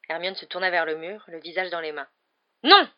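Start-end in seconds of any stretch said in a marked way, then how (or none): 2.6-2.9 high, sudden shouted refusal; cry out. A narrator's reading. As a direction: cry out to stop them. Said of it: "cria Harry". (cri de refus)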